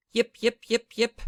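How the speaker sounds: high, loud yapping; small dog yapping loudly; tiny dog yips. Acted. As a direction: yip like a little dog. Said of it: "Tiny Dog said".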